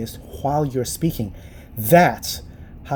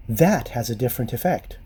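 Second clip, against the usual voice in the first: ordinary talk, nothing marked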